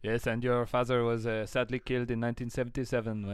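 mock-grave French accent. Recalling a father's death, in mock French accent. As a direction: deliver sad news as a comic Frenchman. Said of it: "when Will does the French accent".

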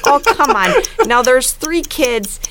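put-on light Minnesota Fargo accent, slowed in places; put-on Fargo Midwest accent. Host telling the plot for the game in a Fargo accent. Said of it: "lean really hard into the Midwest accent".

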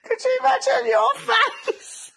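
high, loud, laughing shriek; real helpless laughter. Real, not acted. Mum picturing her daughter's face at the birth, shrieking with laughter. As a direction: squeal it through real laughter. Cracking up at baby afro joke.

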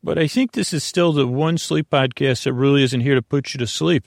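ordinary talk

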